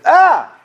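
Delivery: put-on high, loud "Ah!" like a kid's boo; sudden high-pitched kid yell; kid's startling yell. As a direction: burst out yelling to scare someone. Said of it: "but a kid version".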